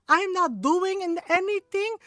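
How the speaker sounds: mock outraged mother, stretching each word; yelling angry mom. Tiny mom whooping her son, outraged imitation. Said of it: "and she's yelling".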